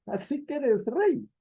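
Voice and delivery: mock Pilate's scornful question; a hammed-up sarcastic king jibe. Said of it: "es una expresión burlona y sarcástica".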